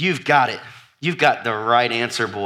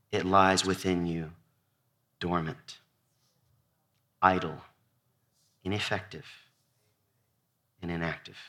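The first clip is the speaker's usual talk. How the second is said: fading to a hush; 7 semitones lower, 9 dB quieter than usual. Acted. Preacher lets list fade to hush.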